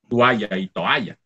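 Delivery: Toalla slowly, then toalla as spoken; slow, careful pair of Toalla and toalla; teacher shows the spoken Spanish form; spelling versus speech. Shown as a demonstration. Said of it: "Y los pronunciamos así".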